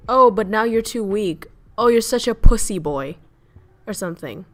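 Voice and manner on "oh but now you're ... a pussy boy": mocking taunt. Mocking a man for crying.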